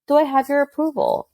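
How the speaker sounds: put-on light high kid voice; approval-seeking boy. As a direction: imitate a boy wanting mom's approval.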